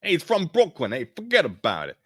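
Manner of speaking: loud, high Brooklyn tough-guy voice; hey, forget about it, Brooklyn style; a brash New Yorker greeting; Brooklyn accent. Acted. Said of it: "Josh's voice is gonna be, he's from Brooklyn".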